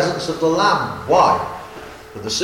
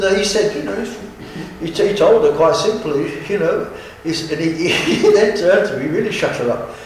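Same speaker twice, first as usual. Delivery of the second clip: ordinary delivery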